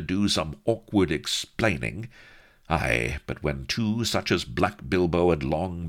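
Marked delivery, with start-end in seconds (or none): none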